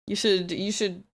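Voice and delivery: ordinary delivery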